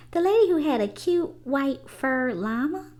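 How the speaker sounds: put-on a kid remembering the cute llama; child recalling. A narrator's reading.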